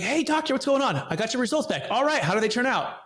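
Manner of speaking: very high, hopeful greeting; eager patient. Retold or imitated. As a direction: play the keen young athlete on the phone. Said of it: "I'm like".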